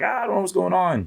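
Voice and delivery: high-pitched mock panic of the wife; panicked wife. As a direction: squeak it out like the frantic wife. Wife voiced in police story.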